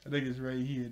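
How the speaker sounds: ordinary talk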